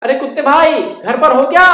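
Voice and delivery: high, loud bird calling. Acted. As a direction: call to the mouse at his door.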